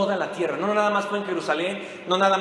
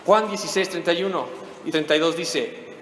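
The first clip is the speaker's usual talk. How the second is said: usual speaking voice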